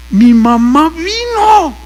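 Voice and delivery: slow, high wail of joyful tears; imitated crying. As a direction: wail it out slowly, imitating a weeping son. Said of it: "llorando me dice". (llanto imitado, lento y agudo)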